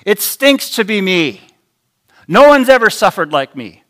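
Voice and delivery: loud, high whining complaint; self-pitying grumble. Retold or imitated. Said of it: "Lies like this".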